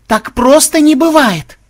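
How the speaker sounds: very high, loud, drawn-out shriek; shrill cry of outrage; outraged outburst. Acted. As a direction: explode into slow, shouted disbelief. Melissa's denial bursts into outrage.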